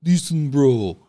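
put-on Ukrainian neighbour voice; accented, insistent mock Ukrainian complaint. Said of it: "people that live above me are like".

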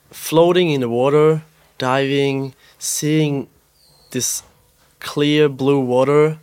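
usual speaking voice

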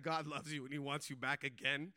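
quiet repeat. Retold or imitated. Repeating the message so as not to ruin the moment, quietly. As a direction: say it simply and low, keeping the moment. Repeating his one-liner to the guard.

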